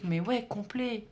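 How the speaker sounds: normal talking voice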